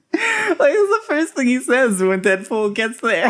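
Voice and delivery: high, giggling delivery; laughing through every word; real laughter, pitch high. Real, not acted. Laughing at the scene.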